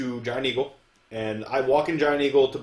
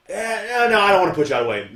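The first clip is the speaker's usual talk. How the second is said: lightly voiced man declining, louder and higher; voiced passenger; 7 semitones higher, 7 dB louder, faster than usual. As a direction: hem and haw loudly as the passenger.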